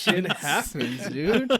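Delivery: slow, laughing through the words; giggling repeat of the line. Real, not acted. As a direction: crack up while repeating the punchline. Repeating punchline while laughing.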